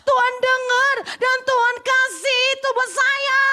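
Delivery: loud high joyful testimony; loud high praise in testimony; joyful proclaiming. Real, not acted. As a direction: proclaim it with thanks. Triumphant testimony climax.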